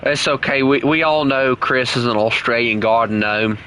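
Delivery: put-on Aussie accent, teasing a friend; Australian accent. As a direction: rib him in mock Australian. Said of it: "keep on talking in this, uh, this accent".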